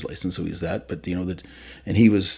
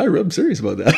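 laughing quote; 7 semitones higher, 4 dB louder than usual. Retold or imitated. Retelling his warning, laughing.

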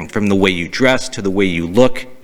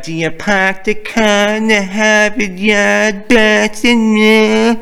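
high, slow, drawn-out mock Boston accent; bad Boston accent; 11 semitones higher, much slower than usual. Support rep mocking a caller's accent, bad Boston accent. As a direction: lay on a terrible Boston accent. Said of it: "That was the worst Boston accent".

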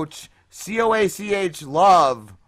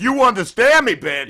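higher, louder mocking outburst; mock aggression; 5 semitones higher, 5 dB louder than usual. Podcaster mocking a website quote, aggressively. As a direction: throw it out as a rude mock demand.

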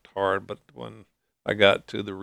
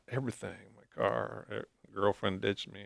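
ordinary talk

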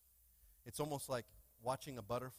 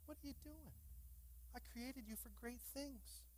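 high, soft, pleading call; God coaxing his child to fly, pleading; 8 semitones higher, 5 dB quieter than usual. Acted. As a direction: plead gently from above. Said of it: "he's going like".

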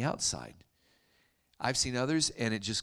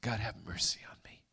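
breathy, whispered private prayer; retold inner thought; 2 semitones lower than usual. Preacher's thought after the bearded kiss, whispered plea. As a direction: whisper it under your breath as a stunned aside. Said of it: "And I thought".

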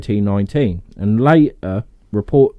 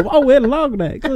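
cartoon dinosaur voice. Voicing Land Before Time dinosaurs.

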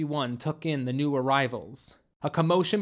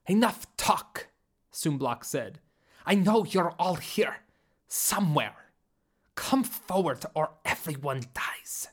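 lightly voiced alien leader. Sumblok demanding the hidden runaways, a hint of menace. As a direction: threaten the hidden humans coldly.

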